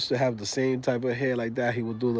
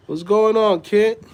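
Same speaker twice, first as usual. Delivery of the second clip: calling out; 8 semitones higher, 7 dB louder, slower than usual. Real, not acted. Greets kids who just popped up.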